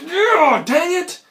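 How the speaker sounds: high, loud mock exasperation; frustrated outburst. Visitor losing a dollar to the hustler, comically exasperated. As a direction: burst out in comic annoyance.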